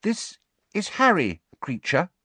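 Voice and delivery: high-pitched, careful introduction; tentative. A narrator's reading. Hermione introduces her friend to the elf, tentative. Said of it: "said Hermione tentatively".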